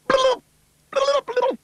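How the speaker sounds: very high, loud squeaky blub; alien babble. Acted. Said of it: "said the little green man".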